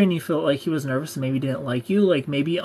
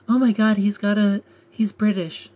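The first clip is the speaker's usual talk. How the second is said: higher-pitched imitation of a gushing fan; mock swooning; 5 semitones higher, 4 dB louder than usual. Mocking how Disney sells British men, mock swooning. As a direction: gush it in mock excitement.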